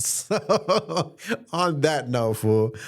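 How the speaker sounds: high, slow, laughing wind-down. Real, not acted. Closing the podcast episode, still laughing. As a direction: ease out of the laugh.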